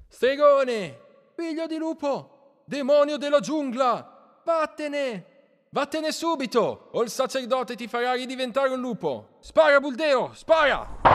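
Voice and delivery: very high, distant angry mob cries; shrill-pitched crowd yelling from afar, drawn out; shouting villagers. A narrator's reading. Villagers driving out the wolf-boy, shouting. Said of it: "contadini gridare".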